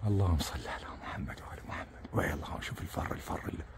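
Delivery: low, breathless whisper of a blessing; whispered prayer. Real, not acted. To viewers watching the chick, he whispers a prayer. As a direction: hush your voice and murmur the prayer.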